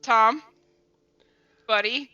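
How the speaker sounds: usual speaking voice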